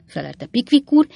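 usual speaking voice

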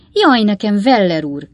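mild theatrical outcry; exclaiming maid. A narrator's reading. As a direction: cry out like a startled maid. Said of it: "kiáltott fel a csinos kis szobalány".

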